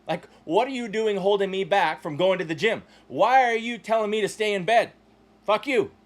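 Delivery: normal talking voice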